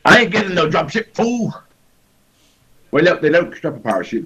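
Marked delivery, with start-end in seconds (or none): 0.0-1.5 Mr. T impression. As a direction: bark it like Mr. T. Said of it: "A-team style".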